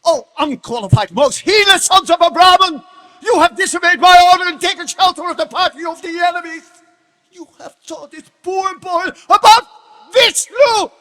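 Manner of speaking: roaring at the teachers in rage; a king exploding at traitors; furious tirade. Acted.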